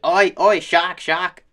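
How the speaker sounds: loud, high mock Aussie calling out; Australian accent. Surfers spot a shark, loud mock Australian accent. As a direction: imitate an Australian surfer yelling a sighting. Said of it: "super sick Australian video I saw".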